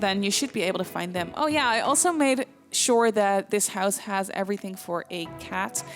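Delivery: normal talking voice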